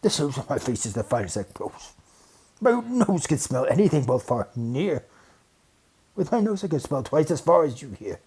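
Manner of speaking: lightly voiced proud bear; bragging bear. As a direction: puff up proudly.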